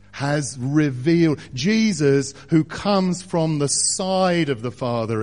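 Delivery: fervent preacher building to a peak; preaching at full force. Acted. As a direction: lift it and press every phrase home. Proclaiming John 1:18 loudly.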